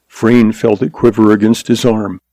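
ordinary delivery, nothing marked

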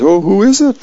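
a lightly voiced eager Earl; curious husband. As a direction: perk up with a high excited question. Husband asks in Pickles.

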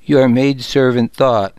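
plain reading voice

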